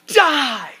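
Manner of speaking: lightly voiced evil queen screaming; witch's cry. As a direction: cry it out like a cruel witch going for the kill. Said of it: "she cries out".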